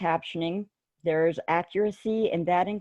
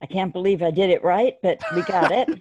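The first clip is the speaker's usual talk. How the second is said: ordinary talk, nothing marked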